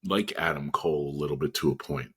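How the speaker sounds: ordinary talk, nothing marked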